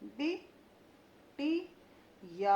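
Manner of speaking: बिटिया very slow with a long rising final या; drawn-out बिटिया. Shown as a demonstration. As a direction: stretch the या of बिटिया. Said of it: "किस प्रकार से मैं ... उच्चारण".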